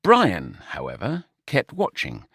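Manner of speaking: usual speaking voice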